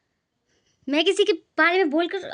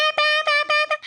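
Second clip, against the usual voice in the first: high imitated car horns; high honking noises of traffic outside; 13 semitones higher, slower than usual. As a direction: mimic honking vehicles. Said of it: "पर वो पै".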